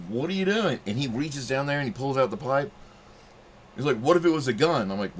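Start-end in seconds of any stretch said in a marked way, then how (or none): 0.1-0.8 startled retort. Retold or imitated. Cop bursts in reaching for gun. 4.0-4.9 cop scolding. Retold or imitated. Cop scolding after finding pipe.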